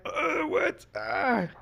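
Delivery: very high, dazed question. Retold or imitated. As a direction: squeak it out bewildered. Retold dazed confusion after impact.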